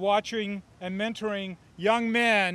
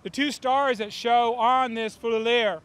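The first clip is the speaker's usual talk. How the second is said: ordinary talk, nothing marked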